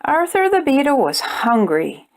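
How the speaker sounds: plain reading voice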